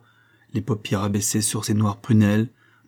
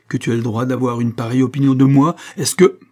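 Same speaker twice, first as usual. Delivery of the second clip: loud outrage climbing high; indignant; 4 semitones higher, 7 dB louder than usual. A narrator's reading. Daughter outraged at her father's opinion, indignant. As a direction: build angrily until cut off. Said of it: "dit-elle avec indignation".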